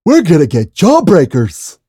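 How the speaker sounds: loud, high-pitched cartoon shout; excitable cartoon rallying cry; cartoon schemer impression. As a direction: push it high and loud, hammed-up. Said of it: "I used to be able to do it really good".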